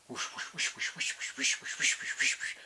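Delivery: soft, voiceless whoosh sounds; soft whoosh of wings. Acted. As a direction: breathe out wing whooshes. Birds taking off.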